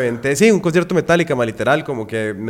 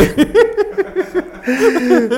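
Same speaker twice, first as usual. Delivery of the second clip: loud, high laughing fit; real laughter; 7 dB louder than usual. Real, not acted. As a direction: burst out in long, high laughter. Friends joking about tinnitus. (carcajada larga, aguda y fuerte)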